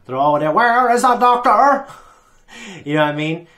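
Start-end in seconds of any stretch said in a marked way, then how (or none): 0.5-1.9 high, drawn-out mock yell; put-on yell, pitched way up; loud mock calling out. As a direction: call it out slow, loud and high, hammed-up. Acting out Daleks hunting the Doctor.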